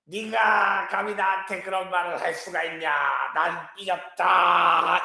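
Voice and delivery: mock toddler whine; imitating a sulky cry. Teacher imitating his toddler's sulky crying, mock whine. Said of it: "이렇게 울어요".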